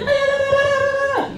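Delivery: very high mocking babble; arguing girl. Girl arguing with him in a room, high mocking babble. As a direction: mimic her nagging as shrill nonsense syllables. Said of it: "She was like".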